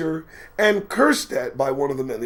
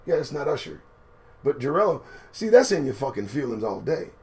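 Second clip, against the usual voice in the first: ordinary delivery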